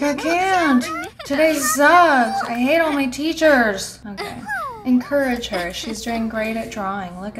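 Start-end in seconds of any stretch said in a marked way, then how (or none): 0.0-4.0 high, loud whiny outburst; mock freak-out. Player voices a child fed up with school, mock mad. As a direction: play up an exasperated tantrum. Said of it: "She's freaking out. So mad".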